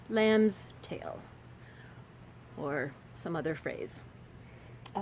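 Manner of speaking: ordinary talk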